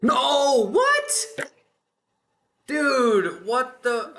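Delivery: loud, high shout of real disbelief; shocked outcry. Real, not acted. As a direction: cry out as the run suddenly fails. Game run suddenly fails.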